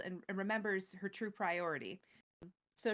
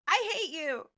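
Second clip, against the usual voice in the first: higher and louder, a mock hateful snap; spiteful retort; 7 semitones higher, 6 dB louder than usual. Norn's weak comeback to Elspeth, mock angry snap. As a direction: spit it out like a sore loser. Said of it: "She just says".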